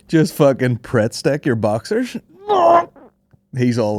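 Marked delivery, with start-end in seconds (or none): 0.0-2.2 laughing mock boast. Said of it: "you wouldn't just fucking be like".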